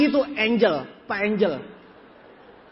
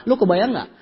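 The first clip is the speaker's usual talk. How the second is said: ordinary delivery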